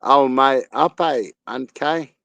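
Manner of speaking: ordinary talk, nothing marked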